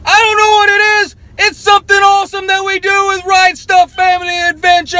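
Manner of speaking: very high, loud, fast shout. Real, not acted. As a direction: yell the outro with full energy. Shouted outro.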